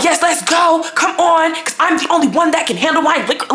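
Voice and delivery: high-pitched imitation of her hollering; mock screaming. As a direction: mimic her screaming, without full volume. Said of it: "And she's still screaming".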